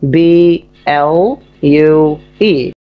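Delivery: slow spelling, letter by letter. Shown as a demonstration. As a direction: spell it out slowly for a child. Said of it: "Is spelled blue".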